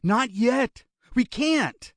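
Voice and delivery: high, urgent plea; begging. A narrator's reading. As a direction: beg in short desperate bursts. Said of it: "Barry begged".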